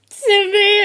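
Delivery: high, loud mock sob; choked-up wail. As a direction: act out failing to hold back tears. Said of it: "I couldn't even do it".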